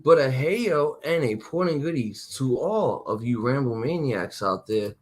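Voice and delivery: ordinary delivery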